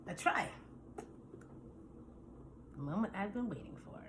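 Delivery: hushed whisper before the taste. Acted. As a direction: whisper it with suspense. Quiet build-up before first taste.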